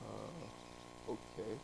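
a baffled pilot going along with it; quiet, resigned mumble; deadpan pilot. Acted. Pilot's flat, baffled reply.

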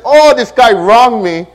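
loud, high, slow complaint; acted indignation. Driver fuming at the guy who cut in, acted indignation. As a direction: play up the grievance, drawn out and loud. Acting out his own indignation.

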